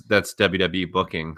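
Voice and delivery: usual speaking voice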